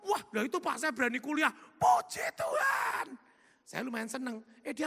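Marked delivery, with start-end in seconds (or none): 1.5-3.1 slow, very high, loud cry of praise; praise shout. Real, not acted. Preacher crying out thanks to God, very high and slow.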